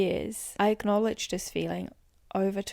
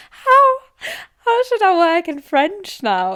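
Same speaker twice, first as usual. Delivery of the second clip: high, loud half-crying wail; mock panic; 10 semitones higher, 11 dB louder than usual. Real, not acted. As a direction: wail it in playful despair. Panics about working in French.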